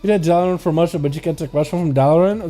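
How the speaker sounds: ordinary delivery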